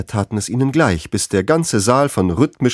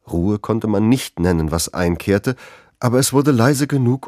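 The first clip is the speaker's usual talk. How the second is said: normal talking voice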